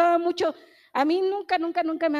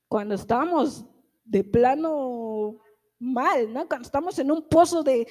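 ordinary talk, nothing marked

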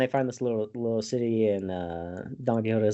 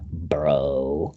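whispered aside; 7 semitones lower than usual. Real, not acted. Aside after describing Toby.

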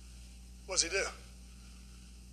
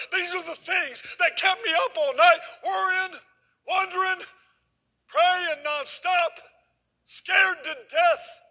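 rising preaching fervor; 8 semitones higher, slower than usual. Real, not acted. Preacher climbs to a shout before the congregation. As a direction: preach it up until scared to death is nearly shouted.

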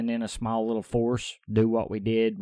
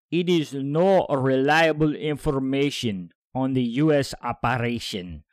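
slow, louder put-on Russian accent; 3 semitones higher, 6 dB louder than usual. Podcaster voices the Defense Ministry's statement in a put-on Russian accent. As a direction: do an amateur Russian accent, heavy and deliberate. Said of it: "do this in a Russian voice".

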